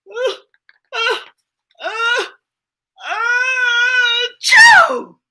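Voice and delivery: rising uh uh uh to a loud high achoo; building sneeze. Acted.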